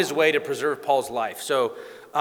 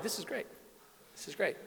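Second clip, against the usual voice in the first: much softer, under the breath; Paul watching the fight, much softer; gleeful aside; 5 semitones higher, 16 dB quieter than usual. Acted. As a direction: savour it quietly to yourself. Paul gloats quietly at chaos.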